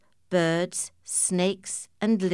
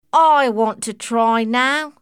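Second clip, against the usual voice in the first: loud, slow eager claim; 5 semitones higher, 8 dB louder than usual. Acted. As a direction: demand a turn loudly. Eel volunteers.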